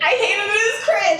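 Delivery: high-pitched, exasperated whine of distaste; exasperated complaint. Real, not acted. Fan sick of hearing cute, exasperated high complaint. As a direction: let the disgust push the voice way up. Venting about an annoying word.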